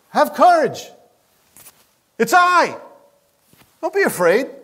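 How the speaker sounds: high, light, cheerful reassurance; smile in his voice. Shown as a demonstration. Jesus cheerfully calming frightened disciples, smiling voice. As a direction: say it with a grin to scared friends. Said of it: "there was a smile in his voice".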